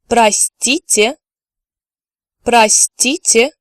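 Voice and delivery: "Простите" said slowly and clearly, twice; slow careful "Простите"; slow drill. Shown as a demonstration. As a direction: draw it out for learners. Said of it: "I'm going to repeat each word twice".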